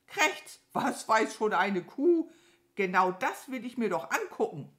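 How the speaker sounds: laute, hohe, schnippische Krähe; verächtlich abwehren; abfällige Krähe. Acted. Krähe weist Kuh ab. (schnippisch, hoch und laut)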